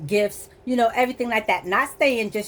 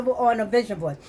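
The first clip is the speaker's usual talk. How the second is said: ordinary delivery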